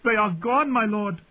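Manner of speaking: high, tearful wail of a handmaid; weeping. A narrator's reading. Handmaid telling the knight they fled, weeping. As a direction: answer helplessly, close to sobbing. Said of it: "Doreah wept".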